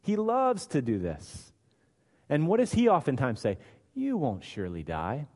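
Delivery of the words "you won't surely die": softer, lower tempter's hush; sly devil. Acted. Preacher voicing the devil, soft sly hush. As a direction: lull them into the lie.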